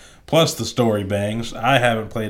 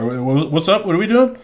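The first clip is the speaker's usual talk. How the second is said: eager; 7 semitones higher, 5 dB louder than usual. Retold or imitated. Acting reaction as character gets up.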